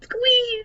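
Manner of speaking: high, loud fan squeal; squeal of joy. Acted. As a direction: squeal with starstruck glee. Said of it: "These are squeals of joy".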